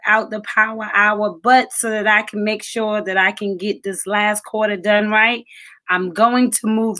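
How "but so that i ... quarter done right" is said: usual speaking voice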